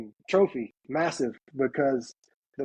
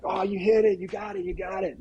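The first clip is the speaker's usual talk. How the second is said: excited retold reaction; 6 semitones higher than usual. Dad reacting to son's shot.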